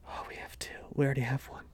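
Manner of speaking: whispered, overwhelmed recollection; hushed realization. Retold or imitated. Husband retelling his reaction to pregnancy news, hushed. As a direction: drop to a near-silent whisper, imitating stunned disbelief.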